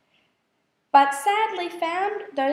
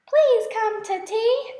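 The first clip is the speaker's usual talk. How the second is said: higher and louder inviting call; 6 semitones higher, 4 dB louder than usual. A narrator's reading. Miss Spider calling to the bumblebees outside, higher louder invitation. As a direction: call out eagerly to the passing bees. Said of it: "Miss Spider cried".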